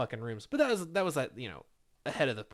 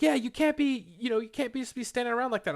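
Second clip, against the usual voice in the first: mock nagging coworker; 9 semitones higher than usual. Rita telling the worker to stop standing around, mock nagging voice. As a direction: nag him like a petty supervisor. Coworker scolding him, mimicked.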